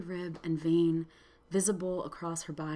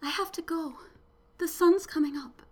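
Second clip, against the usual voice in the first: faint fragile voice; 9 semitones higher than usual. A narrator's reading. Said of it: "The shrieking had faded to a whisper".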